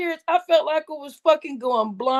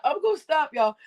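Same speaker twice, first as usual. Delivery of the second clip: normal talking voice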